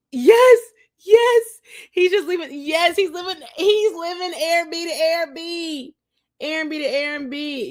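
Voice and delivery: high, loud burst of disbelief; excited exclaiming. Real, not acted. Vlogger to viewers, worked up and amazed. Exclaiming about his constant travel.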